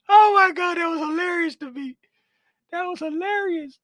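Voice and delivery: high, breathless laughing talk; words squeezed out through laughter. Real, not acted. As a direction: still cracking up. Still laughing after outburst.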